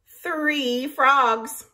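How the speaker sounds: ordinary talk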